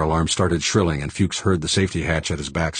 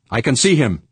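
higher-pitched excited shout; 7 semitones higher, 4 dB louder than usual. A narrator's reading. Crewman sighting the attacking ship, shouted. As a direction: call out the sighting. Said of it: "Noden shouted".

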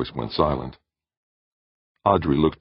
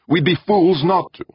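snapping; 7 dB louder than usual. A narrator's reading. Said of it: "Jordan snapped".

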